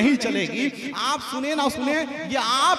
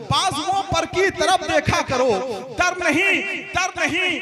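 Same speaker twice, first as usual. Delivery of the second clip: higher, rising declamation with nahin lifted; mushaira recital; 4 semitones higher than usual. Acted. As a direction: repeat the verse building toward the crowd. Repeating couplet to the audience.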